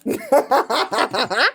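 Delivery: high staccato laugh; real pulsing cackle; amused cackle. Real, not acted. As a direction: burst into a quick run of laughter. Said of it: "Why am I laughing so much?".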